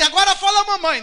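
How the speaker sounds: loud, high-pitched excited urging; eager demand. Acted. Parent urging baby to say mamãe next, excited. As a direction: push the baby for the next word.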